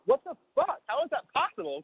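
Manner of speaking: high-pitched incredulous outburst; baffled, indignant protest; retold outraged disbelief. Retold shocked reaction to bank.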